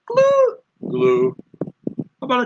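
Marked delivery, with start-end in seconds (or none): 0.0-0.6 "Glue" held very high and loud; high tone one. Shown as a demonstration. Contrasting tone one with tone three on "Glue", high tone. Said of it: "I say a tone one". 0.9-1.4 "Glue" at the bottom of the voice; low tone three. Shown as a demonstration. Contrasting tone three with tone one on "Glue", low tone. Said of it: "then a tone three".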